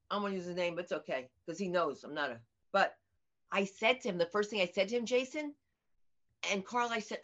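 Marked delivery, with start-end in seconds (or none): none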